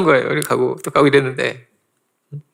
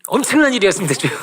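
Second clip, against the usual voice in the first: excited disciples; 6 semitones higher than usual. Retold or imitated. Disciples rushing back to tell Jesus, overjoyed and high-pitched. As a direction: burst in overjoyed like returning disciples. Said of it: "너무나 신나가지고 왔어요".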